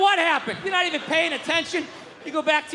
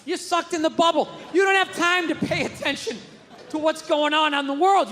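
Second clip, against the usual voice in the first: usual speaking voice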